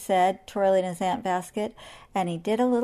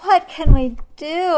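higher, louder, slow plea; anxious plea; 8 semitones higher, 12 dB louder than usual. Acted. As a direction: beg for a plan. Anteater anxious.

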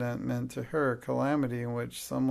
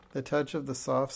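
plain reading voice

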